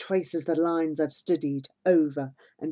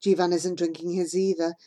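ordinary delivery

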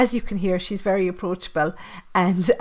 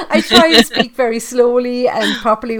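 laughing; 5 semitones higher, 9 dB louder than usual. Real, not acted. Laughing about her accent.

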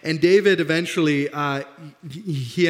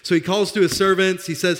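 ordinary delivery

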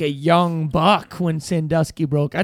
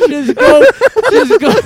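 higher, louder, egging on; urging shout; 13 semitones higher, 7 dB louder than usual. Pretend. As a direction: push them to go, loud. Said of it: "They just said".

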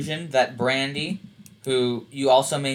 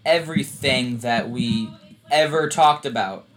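ordinary talk, nothing marked

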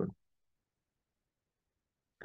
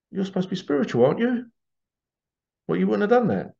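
higher, needling tone; mildly mocking higher jab; sarcastic reproach; 7 semitones higher, faster than usual. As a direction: tease the so-called spiritual one. Mocking a spiritual colleague.

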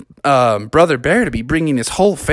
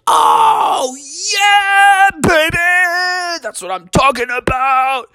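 excited cheer; 13 semitones higher, slower than usual. Acted. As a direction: shout it out in pure glee. Froggy cheers about Disneyland.

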